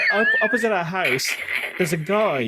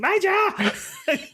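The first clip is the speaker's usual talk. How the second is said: calling dog. Retold or imitated. Owner calling dog at the river, laughing shout. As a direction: shriek the dog's name.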